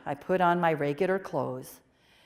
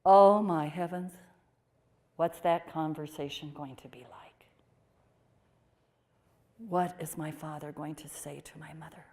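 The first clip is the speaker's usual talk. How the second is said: breathy whisper of dread, words barely voiced; whispered inner worry; 3 dB quieter than usual. Nun recalling her worry about her parents, whispered. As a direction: hush it like a fearful private thought. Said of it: "I thought".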